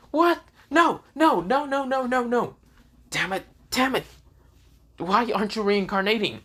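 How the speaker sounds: very high, hammed-up panic; frantic disbelief. She fails to reincarnate Issei, acted frantic frustration. Her resurrection attempts keep failing.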